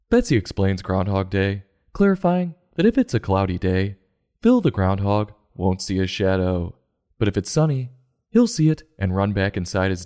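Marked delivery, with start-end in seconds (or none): none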